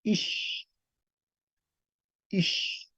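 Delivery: "ish" with a soft, drawn-out sh; demonstrated sh sound. Shown as a demonstration. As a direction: let the sh in "ish" hiss on after the i. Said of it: "luego se transforma en". ("ish" con una sh suave y alargada)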